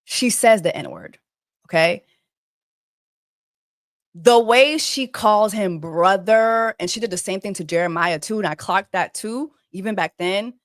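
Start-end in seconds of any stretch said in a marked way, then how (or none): none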